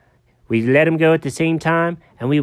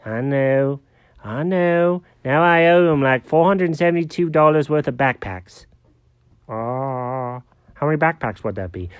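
ordinary talk, nothing marked

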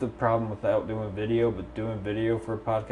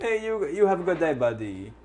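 Apu; 7 semitones higher than usual. Acted. Gas station owner to a customer, mock Indian accent. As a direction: bid farewell as a cartoon Indian clerk. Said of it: "And that's Apu".

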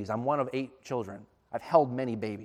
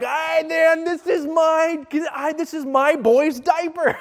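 proud shout; 13 semitones higher, 9 dB louder, slower than usual. Retold or imitated.

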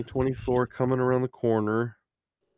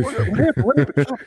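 excited laughing; 9 semitones higher, 5 dB louder than usual. Pretend. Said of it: "It'd be like".

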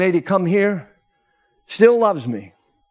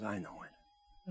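quiet reply; 9 semitones lower, 23 dB quieter, slower than usual. Retold or imitated. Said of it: "she says".

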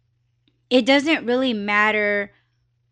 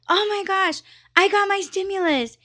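higher-pitched, thrilled outburst; excited girl; 10 semitones higher than usual. Retold or imitated. Woman announcing her stimulus across the restaurant, excited. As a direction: imitate her giddy announcement, pitched well up. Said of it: "this girl was, like, really excited".

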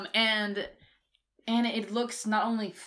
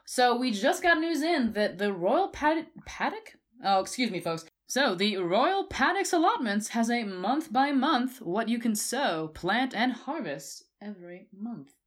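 loud, high-pitched hammed-up newscaster; news reporter voice; 4 semitones higher, 4 dB louder than usual. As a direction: announce it like a TV anchor. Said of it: "like, a news reporter voice?".

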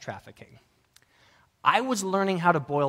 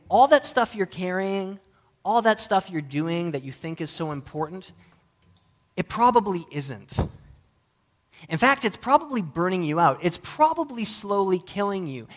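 louder, higher preaching build; 4 semitones higher, 3 dB louder than usual. Acted. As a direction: press the challenge home. Preaching at force.

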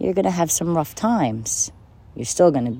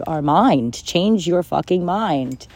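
ordinary talk

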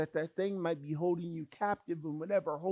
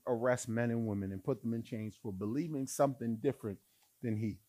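usual speaking voice

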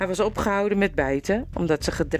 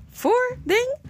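puzzled. Acted. Slaver baffled by word.

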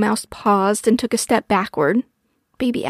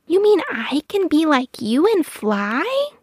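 put-on high, slow wondering child voice; amazed baby owl; 7 semitones higher than usual. A narrator's reading. Baby owl asking mother about flying, amazed. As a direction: ask it high and drawn out in wonder.

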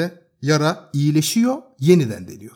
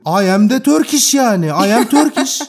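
mock defiant retort; 6 semitones higher, 5 dB louder than usual. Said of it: "Bana da kudurdum en sonunda".

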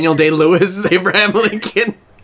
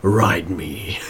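much lower and softer, a deep fake villain rumble; deep menacing villain; 12 semitones lower, 5 dB quieter than usual. Joking with friends as the villain, deep and low. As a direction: claim fatherhood in a deep mock-evil voice. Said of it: "mock villain line".

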